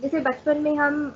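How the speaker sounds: ordinary delivery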